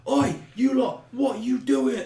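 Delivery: high, loud Cockney shout; hammed-up Cockney yell. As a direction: bark it like a London street tough. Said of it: "the Cockney now".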